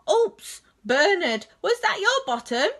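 a sheepish little whoops; Bird, oops. Acted. Bird caught out, admitting to Bernard, sheepish.

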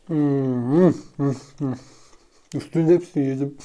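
chewing while humming; talking between bites; mouth full. Real, not acted. Seen 1: Bites pizza at -3s; lips closed, mouth working during "hmm" sounds.

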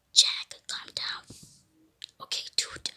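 secretive play whisper; hushed whisper. Pretend. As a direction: whisper so the zombie won't hear. Hiding from zombie, calming Jack.

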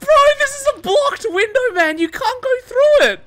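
very high, loud, laughing squeal; real helpless laughter. Real, not acted. Killer player watching survivor hit blocked window, laughing hard. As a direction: protest through a laughing fit.

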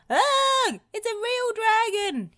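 very high terrified storybook scream; hammed-up panic squeal. A narrator's reading. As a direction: shriek in fright, voice way up. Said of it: "took one look at it and screamed".

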